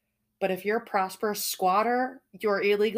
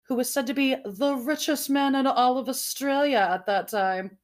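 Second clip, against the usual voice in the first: higher, put-on posh delivery; fancy voice; 4 semitones higher than usual. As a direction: read it in a mock-fancy voice. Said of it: "That was my fancy voice".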